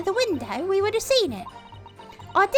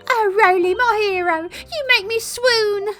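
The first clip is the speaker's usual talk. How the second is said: slow, breathless swoon; swooning lady; 4 semitones higher, 6 dB louder, slower than usual. Acted. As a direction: melt over your hero. Swooning over constable.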